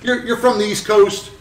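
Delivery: put-on walk the kid through it; imitating Mike Malone. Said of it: "he goes".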